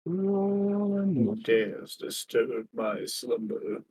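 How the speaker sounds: slow, low, booming awakened deity; sleeping ancient god. Acted. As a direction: rumble it out like a god woken from sleep. Lion voiced as awakened ancient being.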